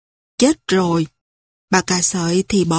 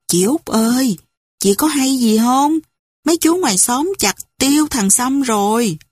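ordinary delivery

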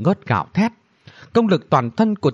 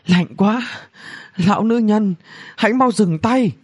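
high-pitched cry at the cold; acted distress, pitched high; freezing plea; 9 semitones higher than usual. A narrator's reading. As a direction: beg for mercy from the cold. Freezing princess begs her to stop.

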